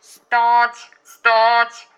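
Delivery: very loud, high, sustained orders; police megaphone. A narrator's reading. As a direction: bellow a halt through a megaphone. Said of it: "zawołali policjanci przez megafon". (głośny rozkaz jak przez megafon)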